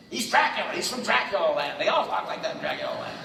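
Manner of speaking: a father scoffing at Dracula; impatient father. Retold or imitated. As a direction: brush it off, exasperated. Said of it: "my father had no time for this".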